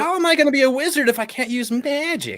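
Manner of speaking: high-pitched indignant complaint; exasperated protest. Real, not acted. Protesting that magic is banned.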